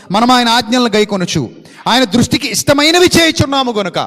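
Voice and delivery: loud, rising pulpit delivery; loud sermon preaching; full-force preaching. Acted. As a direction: drive the verse home to the congregation.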